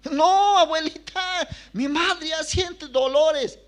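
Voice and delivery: put-on boy pleads with grandmother, high and loud; pleading child. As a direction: beg grandma to hurry.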